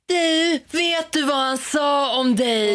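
very high, stretched-out singsong; mock tattling. Classmate passing the whispered insult straight on, mock tattling. Acts out friend telling on her.

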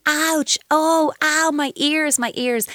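big over-the-top ow, high-pitched and drawn out; hammed-up hurt ears, easing off at the end; theatrical pain. Shown as a demonstration. Parent showing a screaming toddler their ears hurt, theatrical mock pain. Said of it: "theatrically express how your ears hurt".

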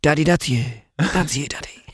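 put-on high, hushed child-like voice; daughter's voice. [name] quoting his daughter recognising him, high and hushed. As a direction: coo it like a little daughter. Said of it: "she says".

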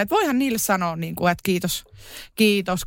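ordinary delivery

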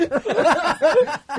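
high burst of laughter; laughing. Real, not acted. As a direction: crack up at your own joke. Laughing at own story.

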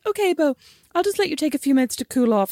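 high-pitched placating voice; imitated teacher. Teacher giving pupil time to cool off, imitated high placating voice. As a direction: pitch it up to humour an upset child. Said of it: "his teacher comes down and goes".

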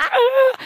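high-pitched mock squeak of shock; mock gasp. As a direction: imitate the scolded sister's squeal. Sister's shocked reply, retold.

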